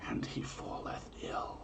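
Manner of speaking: hushed conspiratorial whisper. Acted. Host whispering the omen's punchline to listeners, whisper. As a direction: drop to a secret whisper for the twist.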